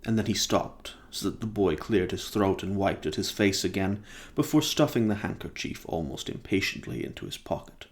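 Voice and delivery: ordinary delivery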